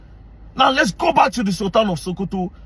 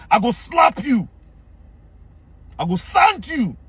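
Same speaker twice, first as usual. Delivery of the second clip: raised-pitch, emphatic threat; higher and heated, warning an opponent; angry threat; 4 semitones higher, slower than usual. Real, not acted. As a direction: threaten them with real anger. Threatening people who challenged him.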